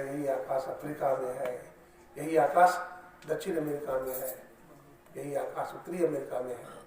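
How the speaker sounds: loud, insistent preaching; fervent preacher rousing the congregation; forceful preaching. Acted. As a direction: drive home each place name. Impassioned preaching about the sky.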